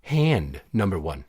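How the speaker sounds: low, slow, deliberate call; slow announcer call. Acted. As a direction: announce the round slowly. Game referee call.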